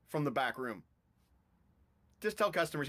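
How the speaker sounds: ordinary talk, nothing marked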